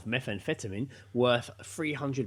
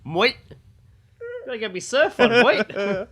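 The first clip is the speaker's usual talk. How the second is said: much higher, louder hammed-up Aussie; mock Australian accent; 10 semitones higher, 7 dB louder than usual. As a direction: play a surfer bloke with a bad Aussie accent. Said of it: "don't know what accent I was doing".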